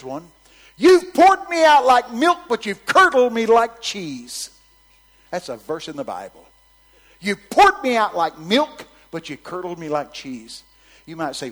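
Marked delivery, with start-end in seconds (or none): 0.8-4.5 loud high preaching; preaching at full force. Acted. Preached verse quote. 7.2-10.6 loud high preaching; preaching at full force. Acted. Repeats verse.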